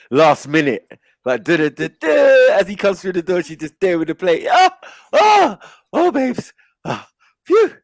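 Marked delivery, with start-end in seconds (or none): 2.0-2.5 high loud flustered duh; panicked rushing sound; mock panic noise. Mimicking wife's last-minute panic. 4.5-7.7 high, breathless flustered wife; hammed-up frazzled wife greeting husband; panicky flustered wife. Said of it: "all panicky and sweaty like Shani".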